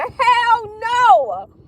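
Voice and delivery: high, long-drawn exclamation; wailed outright refusal; emphatic refusal. Real, not acted. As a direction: stretch it out, high and adamant. Refusing the idea of jail.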